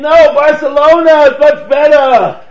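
loud, high-pitched shout; screamed argument. Retold or imitated. Old man yelling across an argument, shouting. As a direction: holler it like a heated old man. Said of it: "The guy screamed".

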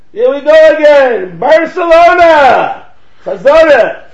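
loud, high, drawn-out yell; relaunched shouting argument. Retold or imitated. Old man launching back into the argument, shouting. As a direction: bellow it out, stretching the name. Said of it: "he launched".

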